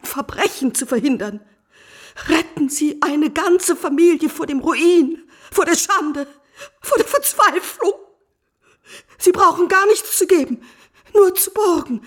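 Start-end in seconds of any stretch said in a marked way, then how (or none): 2.3-11.5 pleading, rising to a sob on Verzweiflung; a plea that cracks into crying; desperate plea. Acted. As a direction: beg him, voice breaking at the end. Wife begs Crevel for money.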